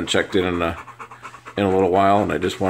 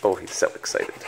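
whisper; 3 semitones lower, 6 dB quieter than usual. Real, not acted. Repeated to dog.

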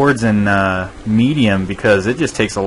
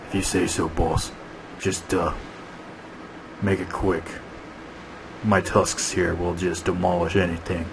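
soft, low-key menacing dinosaur; Big Mike; 3 semitones lower, 8 dB quieter than usual. Acted. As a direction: quietly threaten like a heavy beast. Voices grumbling dinosaur Big Mike.

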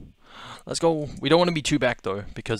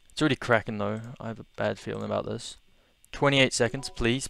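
usual speaking voice

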